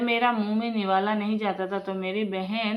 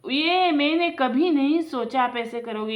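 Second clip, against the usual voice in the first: repeated higher and louder, stung; hurt, louder reproach; 5 semitones higher, 6 dB louder than usual. Acted. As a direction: say it again, hurt and raised. Brother repeats the reproach harder.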